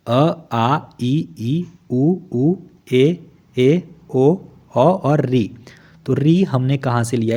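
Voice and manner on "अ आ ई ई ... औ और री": each vowel sound said separately and clearly, one by one; reciting vowels. Shown as a demonstration. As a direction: chant the vowels अ, आ, ई, ई, उ, ऊ like a teacher. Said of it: "ये ग्यारह हैं संख्या में।".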